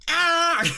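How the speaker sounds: loud, high horn honk; imitated horn blast. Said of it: "it went".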